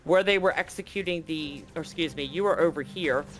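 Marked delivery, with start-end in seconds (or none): none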